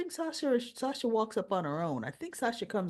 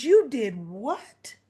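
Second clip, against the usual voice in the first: louder, very slow stunned question; can't believe what you heard; mock shocked Willow; shocked disbelief; 2 semitones higher, 7 dB louder, much slower than usual. Willow shocked by arrest news.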